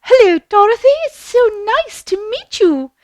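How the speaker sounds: high, loud, sugary good-witch voice; very high fairy-lady greeting; Glinda's greeting. Acted. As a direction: sing out a gushing hello. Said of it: "And she says".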